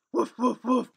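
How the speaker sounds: high, loud woofs; barking like a dog. Acted. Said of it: "she barks".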